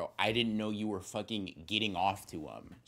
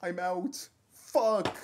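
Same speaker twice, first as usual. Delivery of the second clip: high yelp; 10 semitones higher, 5 dB louder, much slower than usual. Real, not acted. Rushing off to the bathroom.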